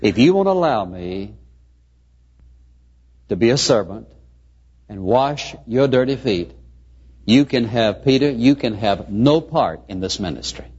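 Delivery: low-pitched, solemn answer with long pauses; measured, low rebuke as Jesus; grave acted admonition. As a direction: admonish in a low voice, pausing between phrases. Jesus answers Peter's refusal gravely.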